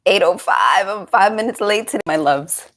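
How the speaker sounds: ordinary delivery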